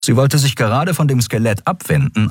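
plain reading voice

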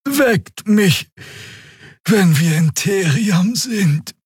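high, very slow, drowsy voice; languid, stretched-out request; sluggish. A narrator's reading. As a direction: drawl it lazily as if dozing off. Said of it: "sagte Xia träge".

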